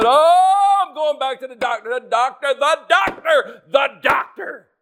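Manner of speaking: high whining, the doctor said over and over; hammed-up hypochondriac moaning; mock whiny complainer. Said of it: "you talk to some folks".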